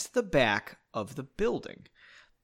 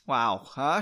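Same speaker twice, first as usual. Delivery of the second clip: ordinary delivery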